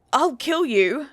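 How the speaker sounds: sudden loud threat from someone shaken; reflexive threat. A narrator's reading. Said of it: "I said reflexively, trying to repress".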